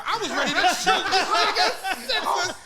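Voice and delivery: loud, high, laughing through the words; cracking up while venting; half-shouted laughing rant; laughing outrage. Real, not acted. Laughing outrage at friend's bet.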